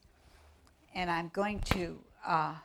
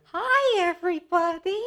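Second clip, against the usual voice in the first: put-on much higher, bright child greeting; little girl puppet voice; 11 semitones higher, 8 dB louder than usual. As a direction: chirp hello as a little girl. Puppet Little Red greets audience.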